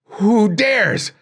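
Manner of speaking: high, loud furious demand; hoarse angry demand. Acted. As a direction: hurl the question at the courtiers in outrage. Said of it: "he demanded hoarsely".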